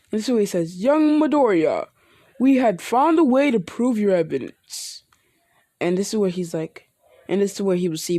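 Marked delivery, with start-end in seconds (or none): 0.8-5.0 hero voice. Acted. All Might telling Midoriya about the proof, lightly voiced hero. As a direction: speak like a proud mentor.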